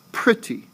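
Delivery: pretty with a high, stressed pre; stress on first syllable. Shown as a demonstration. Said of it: "that of course is stressed".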